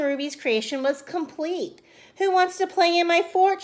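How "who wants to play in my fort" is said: high, louder call. A narrator's reading. Ruby calling her brothers to the fort. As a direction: call out across the yard. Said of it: "she called".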